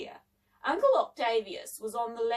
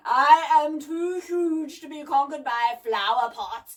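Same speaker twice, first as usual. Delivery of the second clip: loud, slow, stern boom; slow, commanding monster; stern gramophone giant; 4 semitones higher, 9 dB louder, slower than usual. A narrator's reading. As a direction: order it sternly in a big mechanical voice. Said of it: "said the gramophone sternly".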